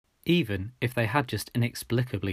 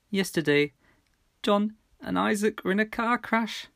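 higher, trembling news delivery; forcing the words out; breaking bad news; 11 semitones higher than usual. A narrator's reading.

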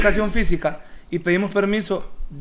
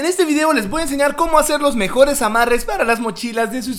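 higher, hyped product-tester voice; Mexican YouTuber voice; 6 semitones higher than usual. Retold or imitated. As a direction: imitate an eager reviewer host. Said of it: "él habla bien raro".

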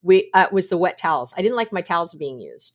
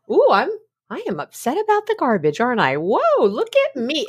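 higher, mock-amazed at yourself; amused self-discovery; 5 semitones higher than usual. Noticing herself upset about the garbage, mock-amazed. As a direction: marvel at your own reaction, swooping up in pitch.

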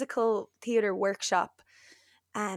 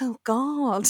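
laughing groan; 3 semitones higher than usual. Retold or imitated. Friend reacts to compliment.

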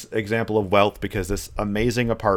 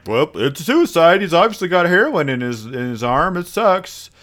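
dismissive cops; 6 semitones higher, 3 dB louder than usual. Retold or imitated.

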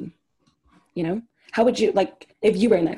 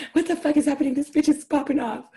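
higher-pitched mock disbelief; acted shocked reaction; 6 semitones higher than usual. Friend imagining recording the professor's outburst, shocked. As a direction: play a stunned onlooker, voice lifted.

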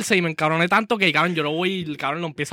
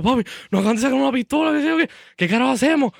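retold panic; 7 semitones higher, faster than usual. Calls dad in panic.

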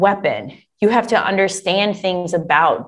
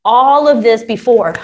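usual speaking voice

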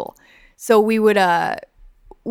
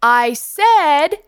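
high, louder called repeat; mock loud repeat; 6 semitones higher, 6 dB louder than usual. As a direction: call it again across the street. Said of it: "And we would just say".

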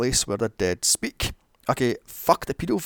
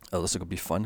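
ordinary talk, nothing marked

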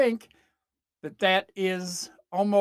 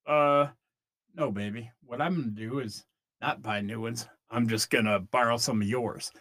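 lazy dude voice; 8 semitones lower than usual. Acted. Boyfriend planning to borrow her sweats, low and lazy.

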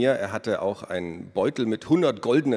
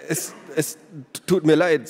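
hiccupping; 7 semitones higher, 5 dB louder than usual. A narrator's reading. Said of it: "sagte Odo Winter und hickste".

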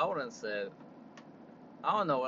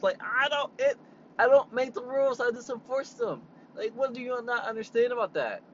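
heated rant; 7 semitones higher than usual. Real, not acted. Ranting about a customer angry at the rules, heated. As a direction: fire it back, fed up.

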